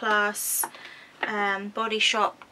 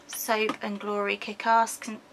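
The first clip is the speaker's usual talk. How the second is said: usual speaking voice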